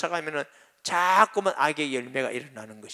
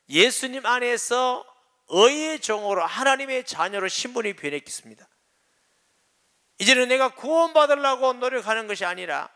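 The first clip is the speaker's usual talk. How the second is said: louder, higher preaching voice; fervent preaching; 7 semitones higher, 5 dB louder than usual. Acted. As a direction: proclaim it with rising fire. Preaching climax.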